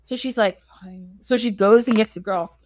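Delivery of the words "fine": tiny, near-silent concession; grudging surrender. Acted. Wife giving in to husband's demand, grudging mumble. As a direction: give in under your breath, very softly.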